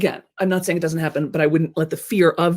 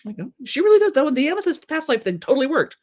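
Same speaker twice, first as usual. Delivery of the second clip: high, excited gushing praise; gushing fans; 11 semitones higher than usual. Retold or imitated. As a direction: rave like a true believer. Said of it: "Other people around the campfire going".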